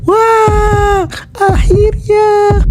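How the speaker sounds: high, slow excited shout with a sob; overjoyed shout. Acted. Mother anticipating the proposal, joyful shout. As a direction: cry out in joy.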